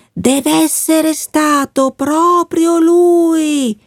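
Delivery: high, slow, otherworldly wind voice; the talking wind. A narrator's reading. Wind gossiping in the treetops about the boy, high and slow. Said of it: "La voce del vento".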